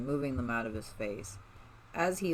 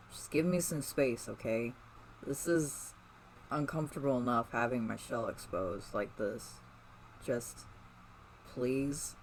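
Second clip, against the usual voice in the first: softer, pleading and drained; begged; 6 dB quieter than usual. A narrator's reading. Donnie begging her for space, quietly pleading. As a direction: ask quietly, almost giving up. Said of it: "he begged".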